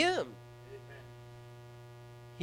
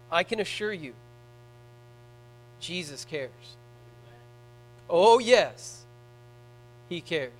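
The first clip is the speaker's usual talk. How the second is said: softer, gentle assurance that Jesus cares; tender reassurance; 4 semitones lower, 5 dB quieter, slower than usual. Real, not acted. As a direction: ease the voice down to comfort the grieving.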